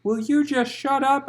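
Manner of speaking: high, loud irritated snap; exasperated loud chiding. Acted. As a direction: tell her off mid-labour. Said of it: "Farah chided".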